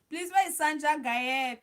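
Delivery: loud, insistent call to a viewer. Real, not acted. Host calls viewers to come into the livestream, calling out.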